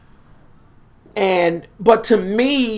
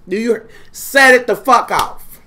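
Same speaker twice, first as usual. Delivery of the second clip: ordinary talk, nothing marked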